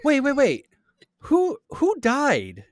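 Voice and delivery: high, loud, startled disbelief; stunned "wait, who died?"; shocked. Real, not acted. Shocked that the actor is alive.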